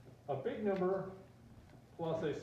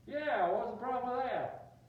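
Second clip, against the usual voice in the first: high, loud, slow challenge; defiant back talk; 8 semitones higher, 5 dB louder, slower than usual. Acted. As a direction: talk back to the cop, hammed-up. Student talks back to cop.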